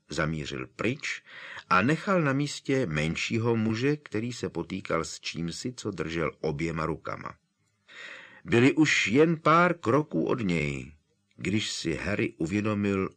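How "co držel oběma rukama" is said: plain reading voice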